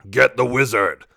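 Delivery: growling orc. A narrator's reading. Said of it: "one of the orcs growls".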